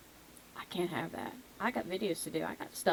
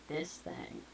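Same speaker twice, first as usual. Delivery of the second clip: 1 soft whisper; 6 semitones lower, 14 dB quieter than usual. Real, not acted. Dropping voice to whisper.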